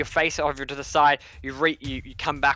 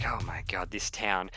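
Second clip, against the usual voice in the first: low, soft aside; weary dismay; 6 semitones lower, 9 dB quieter than usual. Acted. Hannah heading back inside, low muttered dismay. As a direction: mutter it in resignation.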